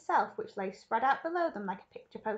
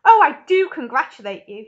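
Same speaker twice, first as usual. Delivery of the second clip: loud, gushing congratulations; excited cry; 4 semitones higher, 15 dB louder than usual. A narrator's reading. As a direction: congratulate at the top of your delight. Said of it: "he cried".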